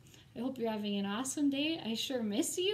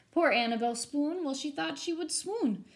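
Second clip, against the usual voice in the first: put-on lively rhyming read-aloud for kids; storybook reading; 3 semitones higher, 4 dB louder than usual. A narrator's reading. Teacher reading a rhyming ghost story to her students, animated. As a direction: read it to the class with bounce and drama.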